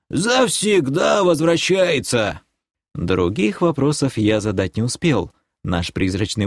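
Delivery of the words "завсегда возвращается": протяжно, повышенным стариковским голосом; успокой небрежно, растягивая слова; беспечный старик. A narrator's reading. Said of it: "пожал плечами Махруша". (беззаботно и медленно, высоким голосом)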